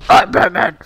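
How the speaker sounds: loud Batman growl. Pretend. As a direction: boom out the Batman line. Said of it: "That was so loud".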